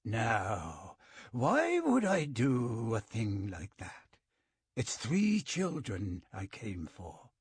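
slow, cold, lilting menace; a calm killer savoring each word. A narrator's reading. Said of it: "his voice strangely cold and lilting".

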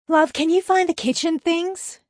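high, loud call from another room. A narrator's reading. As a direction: call through the house to your partner. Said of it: "a voice called from inside the house".

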